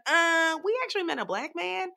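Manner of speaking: high, hesitant mock correction; awkward backtrack. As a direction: sheepishly take it back. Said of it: "And they're like".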